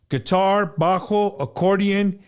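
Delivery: usual speaking voice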